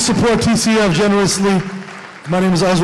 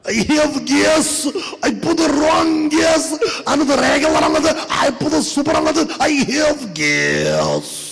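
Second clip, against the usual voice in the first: put-on panicked Russian accent; 8 semitones higher than usual. Russian woman bursting into the shop, panicked Russian accent. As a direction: wail it like a flustered Russian woman.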